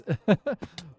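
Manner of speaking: high, loud giggle; laughing. Real, not acted. Streamer amused by own drag name, laughing. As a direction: crack up at your own joke.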